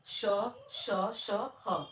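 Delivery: श and ह sounded singly on warm air; breathy sibilants. Shown as a demonstration. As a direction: let the breath flow through each श, then ह. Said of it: "उसमें गर्म हवा महसूस होती है".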